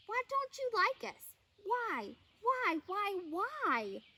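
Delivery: high, pleading questions; pleading why. A narrator's reading. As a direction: beg them to come out. Bear pleading at the hole.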